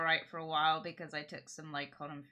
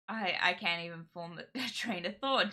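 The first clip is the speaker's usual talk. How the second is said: ordinary talk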